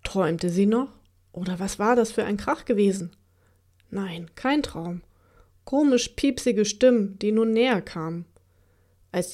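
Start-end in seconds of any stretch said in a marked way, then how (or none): none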